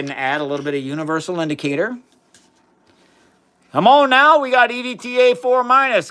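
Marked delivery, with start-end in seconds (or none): 3.7-6.1 high, loud shout-out; excited hype. Real, not acted. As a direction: hype up the chat with a raised, delighted call. Hyping a chatter's chemistry name.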